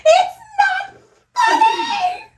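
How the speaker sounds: put-on very high, loud child's wail; imitated screaming and crying. Friend imitating boy in phone background, mock screaming cry. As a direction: shriek it like a sobbing little boy, in fun. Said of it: "background screaming and crying".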